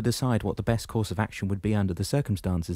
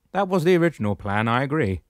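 higher, louder commanding shepherd voice; booming old shepherd, pitched up; 7 semitones higher, 7 dB louder than usual. Acted. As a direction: address him firmly from above. Damianos speaks.

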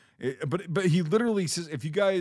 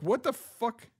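shocked disbelief; 11 semitones higher than usual. Retold or imitated. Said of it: "I'm like".

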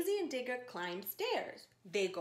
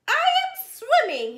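higher, louder triumphant announcement; proud Digger; 14 semitones higher, 14 dB louder than usual. Acted. Digger shows Daisy he swims, high loud proud voice. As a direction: crow it like a delighted little kid.

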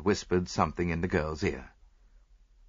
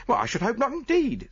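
virtuous; 4 dB louder than usual. Acted. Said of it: "said the chambermaid virtuously".

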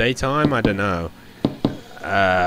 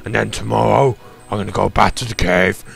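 creepy man voice. Acted. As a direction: play a creepy stranger, half-joking. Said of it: "creepy man voice aside".

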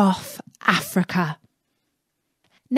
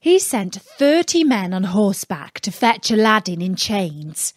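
ordinary delivery, nothing marked

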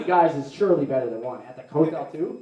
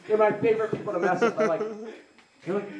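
normal talking voice

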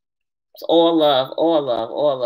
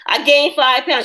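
mock complaining; 7 semitones higher, 3 dB louder, slower than usual. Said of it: "Y'all know I'll be complaining".

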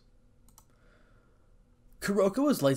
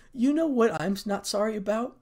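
cold question in a raised pitch; high, cold, pointed question; 9 semitones higher, 4 dB louder than usual. A narrator's reading. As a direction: ask it icily, pitched well up. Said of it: "asked Issei coldly".

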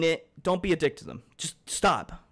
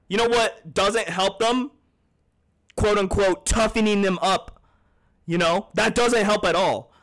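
louder, higher heated rant at chat; 4 semitones higher, 4 dB louder than usual. Real, not acted. Lecturing toxic chatters, heated and loud. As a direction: vent real frustration, pushing hard. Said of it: "I was just fucking heated".

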